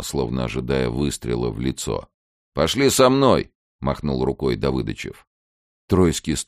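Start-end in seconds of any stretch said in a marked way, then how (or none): 2.5-3.5 high, loud command; calling out. Acted. Lieutenant ordering villager to follow, calling out. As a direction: order someone along from across the yard.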